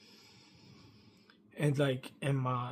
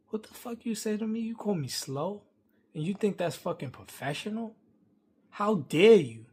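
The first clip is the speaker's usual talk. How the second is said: high, indignant challenge building to a loud punch; retold angry comeback, pitched up; indignant confrontation; 6 semitones higher, 4 dB louder than usual. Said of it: "I was not nice with this guy".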